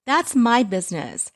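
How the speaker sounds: high, loud with THAT'S hit hard; "That's" punched loud and high; angry retort. Acted. Angry repeat of phrase.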